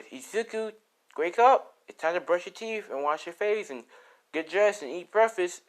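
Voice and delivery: high, bright calling through the door; mom calling. Acted. Mother calling through Izuku's door in the morning, high. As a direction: wake him up like a mother.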